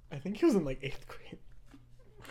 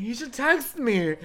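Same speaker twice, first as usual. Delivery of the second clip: high, loud, slow protest; exaggerated put-out insistence; mock insistent; 6 semitones higher, 5 dB louder, slower than usual. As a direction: stretch it out as a petulant demand. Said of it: "Being, like, mad that he wasn't texting me".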